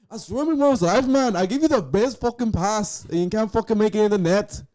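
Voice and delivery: mock British accent. Imagining a British player scolding a teammate, mock British accent. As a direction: rant at a teammate like an English footballer. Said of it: "British accent".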